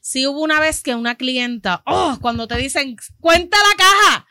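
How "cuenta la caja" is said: loud, slow demanding customer; angry customer. Retold or imitated. Customer accusing the cashier, loud and angry. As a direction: bark the demand for a recount.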